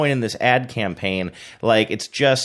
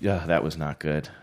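ordinary delivery

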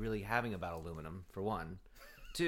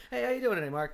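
mimicking his own cheery greeting; 10 semitones higher, 4 dB louder than usual.

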